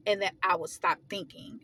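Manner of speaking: ordinary talk, nothing marked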